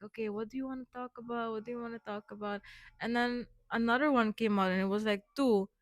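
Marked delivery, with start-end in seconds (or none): 0.0-2.6 quick, soft self-prompting; hushed inner voice. Retold or imitated. Asking herself what to talk about, fast and hushed. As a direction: rattle it off under her breath. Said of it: "I was like".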